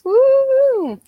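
high, loud woo-hoo cheer; excited whoop. Real, not acted.

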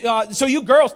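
ordinary talk

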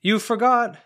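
high, loud call across the picnic area. A narrator's reading. Daniel calling out to the departing family, loud high call. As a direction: shout after someone walking away. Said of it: "Daniel called out to the departing family".

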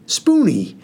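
shocked high gasp of a name. Acted. Mother scolding son in front of the pastor, shocked gasp. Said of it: "my mother gasped".

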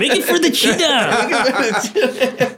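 hammed-up Mexican lilt; mock Mexican accent. Mexican dad giving orders for dinner, exaggerated accent. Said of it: "his dad's Mexican".

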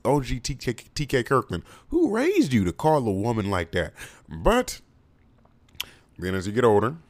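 normal talking voice

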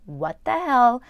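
high, loud mock outrage; judgmental friends. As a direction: imitate a scandalised churchgoer.

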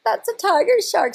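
high, startled recognition; suddenly spot the danger; alarmed realization. Retold or imitated. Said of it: "and I was like".

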